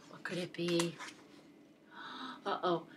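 hushed, low, ominous wondering; soft storyteller's hush before danger; whispered suspense. A narrator's reading. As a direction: whisper it with a gasp of dread. Narrator builds suspense before danger.

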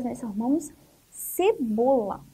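ordinary talk, nothing marked

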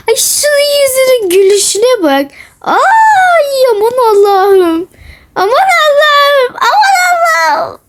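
high squealing delight with laughter; gushing squeal. Real, not acted. Vlogger melting over a fan's cute photo, squealing high.

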